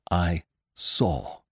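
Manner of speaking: plain reading voice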